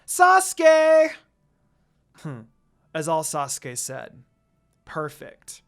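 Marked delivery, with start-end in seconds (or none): 0.1-1.1 high, loud squeal of a name; fangirl shriek. A narrator's reading. Sakura cutting Lucy off to shriek for Sasuke. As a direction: shriek it like a lovestruck fan. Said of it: "cut off by Sakura yelling".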